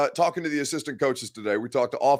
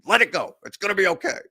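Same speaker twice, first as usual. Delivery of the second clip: higher, pleading mock-soothing "it's gonna be okay"; mock reassurance; 8 semitones higher than usual. Host mock-calming fans about Inhaler Gate, higher and pleading. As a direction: shake the panicking fans and soothe them, half-joking. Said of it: "digitally shake you and say".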